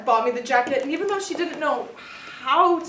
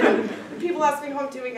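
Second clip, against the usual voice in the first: ordinary talk, nothing marked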